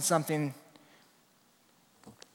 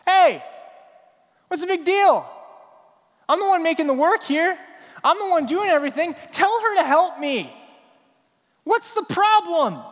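much higher and louder, exasperated protest; frustrated complaint; 9 semitones higher, 5 dB louder than usual. Retold or imitated. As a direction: demand help as the overworked sister. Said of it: "she is frustrated".

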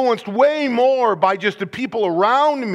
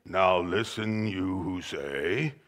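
low, soft, slow and detached; understated low reading without punch; flat low reading; 10 dB quieter, slower than usual. Shown as a demonstration. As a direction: read it low and flat, no force. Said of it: "you can hear [name] not saying".

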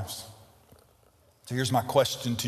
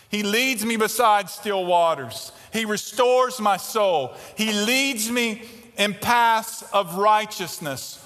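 steady, slowing scripture reading; psalm reading; 9 semitones higher, 5 dB louder, slower than usual. Acted. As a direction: read the psalm reverently, slowing down.

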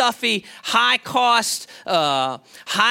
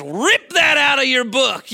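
slow, higher-pitched imitation of a yelled order; retold yell; 6 semitones higher, 4 dB louder, slower than usual. Teacher ordering students to tear out pages, retold yell. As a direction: call it out like a fired-up teacher. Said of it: "That's what he yells".

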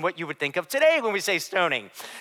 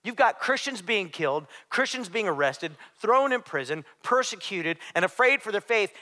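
normal talking voice

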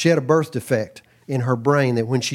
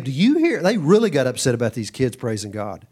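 ordinary talk

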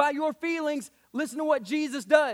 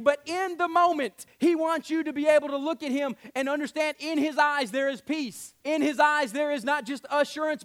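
louder, rising sermon cadence; preaching at full force; 2 semitones higher, 4 dB louder than usual. Acted. Pastor urging the church forward, full preaching. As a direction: press the point home to the congregation.

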